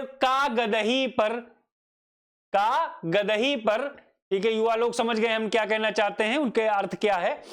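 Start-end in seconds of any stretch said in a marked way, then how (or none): 0.0-1.4 slow, a break after "का", then "गदही पर" as one word; pause after का. Shown as a demonstration. Teacher showing students the first meaning, pause after "का". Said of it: "यहां comma लगाइए". 2.5-4.1 "का" held alone, clear gap, then "गदही पर"; pause after का. Shown as a demonstration. Teacher repeating the first reading for students, pause after "का". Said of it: "यहां comma लगाइए".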